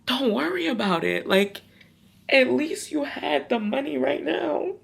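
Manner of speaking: high, gentle reassuring mom. Retold or imitated. Mom calming her crying daughter on the phone, gentle. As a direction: comfort her kindly. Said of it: "my mom is just like".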